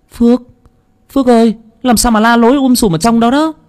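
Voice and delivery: high-pitched, put-on female voice; worried woman calling. A narrator's reading. Woman calls out to shouting Phước. (giọng nữ cao, gọi lo lắng)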